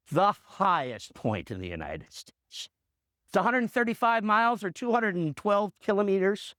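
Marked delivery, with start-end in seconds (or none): 0.1-2.7 very low, drawn-out answer; slow dramatic reveal. Real, not acted. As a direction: stretch the reveal out, pitched well down. Reveal after "guess where they run.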